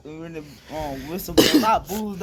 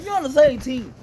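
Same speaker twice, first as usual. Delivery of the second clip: louder, higher exasperated shout; exasperated protest; 7 semitones higher, 9 dB louder than usual. Pretend. Teammate protesting the kick, exasperated shout. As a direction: yell it, fed up.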